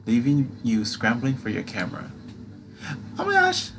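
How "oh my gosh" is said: high startled gasp of words; startled squeal. Acted. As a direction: jump at the knock. Startled by knocking.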